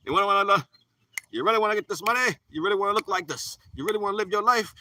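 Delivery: high, loud, pushy mock pitch; voice of the world. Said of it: "And they're like".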